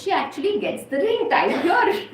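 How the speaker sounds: ordinary delivery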